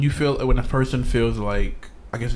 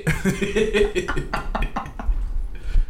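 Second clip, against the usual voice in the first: breathy laugh; 8 semitones higher than usual. Real, not acted. Keeps laughing.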